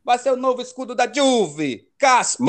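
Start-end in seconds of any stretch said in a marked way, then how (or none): 2.0-2.5 a playful Italian "Caspita", higher and louder; Italian exclamation. Acted. Showing off an Italian word to the podcast hosts, mock Italian accent. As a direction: throw in a hammed-up Italian "Caspita". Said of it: "Gostei da pronúncia".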